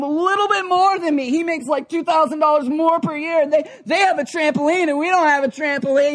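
high-pitched, incredulous complaining; worked-up, high-pitched griping; mock exasperation. Voicing exasperated God and envious comparers.

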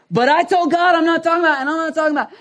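high, loud, rapid mock outburst; exasperated protest. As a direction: refuse in a hammed-up, rising fluster. Acted refusal in marital argument.

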